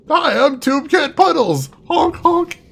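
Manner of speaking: goofy clown greeting, honking. Pretend. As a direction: play a silly party clown. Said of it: "That sounds like a name of a clown".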